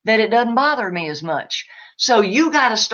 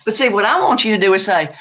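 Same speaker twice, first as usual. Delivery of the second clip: ordinary delivery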